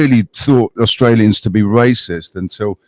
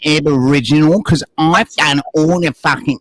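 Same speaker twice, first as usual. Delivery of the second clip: mocking whiny quote; 2 semitones higher than usual. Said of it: "you're trying to tell everybody that".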